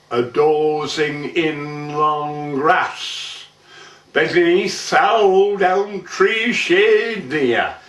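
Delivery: measured, rhythmic dialect monologue; poem recitation. Acted. Man recites an old Burton tale to an audience, theatrical poem recitation. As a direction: declaim it in verse with drawn-out line ends.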